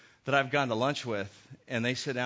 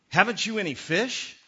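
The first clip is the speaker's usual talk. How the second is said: called question; 7 semitones higher, 6 dB louder than usual. Acted. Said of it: "called out to them".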